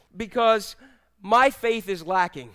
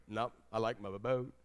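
much lower and softer; reluctant refusal; 9 semitones lower, 11 dB quieter than usual. Acted. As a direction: back out with a low, quiet refusal. Acting out disciple declining to trust God.

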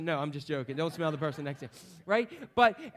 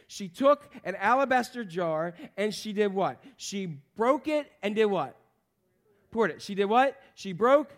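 ordinary talk, nothing marked

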